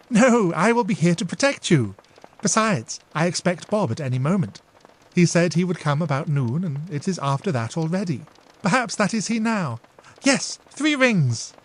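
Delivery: a touch of a protective big sister; protective older sister. Acted. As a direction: promise to protect her. Emily reassures, hears bell.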